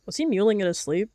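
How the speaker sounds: ordinary delivery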